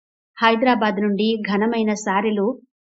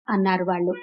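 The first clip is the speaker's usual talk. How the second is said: usual speaking voice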